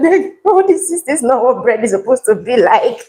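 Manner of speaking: mild imitation of a doubtful husband; a hint of a skeptical husband; husband's inner thought. Husband secretly judging the bread, mildly skeptical. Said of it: "But in his mind".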